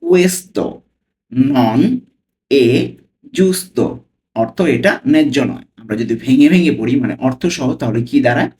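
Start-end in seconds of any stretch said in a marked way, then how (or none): 0.0-3.8 slow, word by word with pauses; teacher modelling the phrase slowly; slow reading. Shown as a demonstration. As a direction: read "Questo non è giusto" slowly, pausing between words. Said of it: "আমি slowly পড়ছি।".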